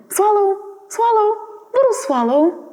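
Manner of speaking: high, gentle plea of a prince; lifted voice of the statue prince; prince pleading. Acted.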